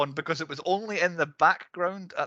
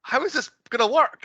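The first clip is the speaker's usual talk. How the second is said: baffled disbelief; 11 semitones higher, 5 dB louder than usual. Retold or imitated. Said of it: "I was like".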